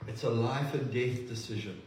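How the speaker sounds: ordinary delivery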